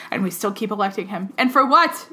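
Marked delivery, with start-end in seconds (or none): 1.4-2.1 high, loud exasperated question; exasperated outburst. Real, not acted. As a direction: throw up your hands in disbelief. Exasperated rant.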